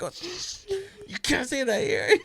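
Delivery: retold giggly shushing, high voice; stifled laughing hush. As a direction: shush a kid while cracking up. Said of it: "And I started cracking".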